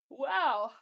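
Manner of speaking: words stretched by a yawn. Real, not acted. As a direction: say it mid-yawn. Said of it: "I'm being stricken by a yawn".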